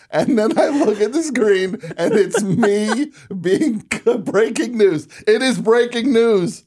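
laughing through the words. Real, not acted. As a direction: giggle while you say it. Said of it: "look at that".

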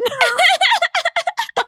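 high burst of laughter. Real, not acted. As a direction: break into a big laugh. Laughs at own joke.

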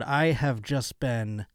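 ordinary talk, nothing marked

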